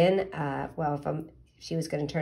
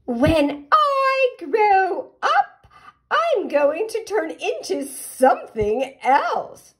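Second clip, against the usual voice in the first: much higher, slow and drawn out like a dreamy little caterpillar; 13 semitones higher, 8 dB louder, slower than usual. Acted. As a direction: stretch every word out high and slow. Caterpillar tells turtle her secret.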